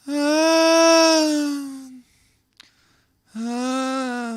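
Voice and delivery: very high, drawn-out cat yowl; imitating cat yowl. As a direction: wail like an old cat at four in the morning. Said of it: "and then she'll just".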